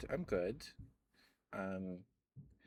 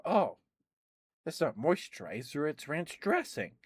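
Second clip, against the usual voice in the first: surprised realisation; 7 semitones higher, 7 dB louder than usual. Retold or imitated.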